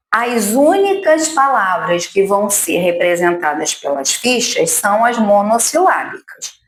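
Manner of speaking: normal talking voice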